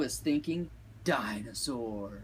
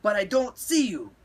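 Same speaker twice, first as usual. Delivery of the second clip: loud, high heroic proclamation; called out; 9 semitones higher, 6 dB louder than usual. A narrator's reading. Skipito introduces himself to unseen amigos, calling loudly. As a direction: announce yourself like a swashbuckler, pitch up. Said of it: "called out Skippy John Jones".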